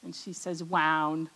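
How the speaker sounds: "wound" with a W for the R. Retold or imitated. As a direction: babysitter mimics the child saying "wound" for round. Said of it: "She says all her R's as W's".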